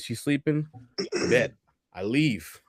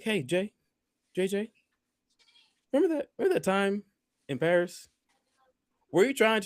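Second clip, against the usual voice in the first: high hesitant question; 11 semitones higher than usual. Retold or imitated. Hesitant question years later.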